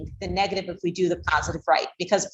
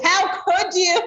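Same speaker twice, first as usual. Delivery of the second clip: higher, indignant "how could you"; a parent scolding with too much drama; mock outrage at a misbehaving child; dramatic scolding; 10 semitones higher, 4 dB louder, much slower than usual. Shown as a demonstration.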